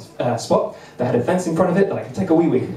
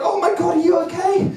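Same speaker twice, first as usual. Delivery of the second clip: high, alarmed oh my God; panicked concern; 11 semitones higher than usual. Retold or imitated. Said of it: "I was like".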